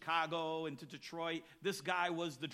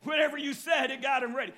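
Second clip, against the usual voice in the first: excited; 9 semitones higher, 12 dB louder than usual. Real, not acted. Preacher's excited punchline.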